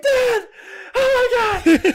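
very high, loud frantic cry; panicked yell. Retold or imitated. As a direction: scream for dad in alarm. Kid panics again.

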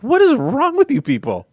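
high, slow, disbelieving cry; retelling a fan request, high and incredulous; incredulous mock outrage. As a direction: act appalled at the fans. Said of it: "I was like".

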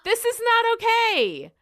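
high-pitched disbelief at the writers; outraged. Real, not acted. Outraged rant about the episode.

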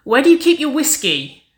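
brash, loud, high-pitched call; brash shouted question; Wynonna. A narrator's reading. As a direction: call it out from the kitchen. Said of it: "Wynonna's brash voice called from the kitchen".

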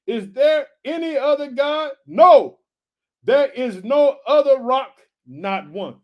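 high preacher read, swelling to a loud peak; preaching scripture. Acted. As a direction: proclaim God's words to the family. Reads God's words aloud.

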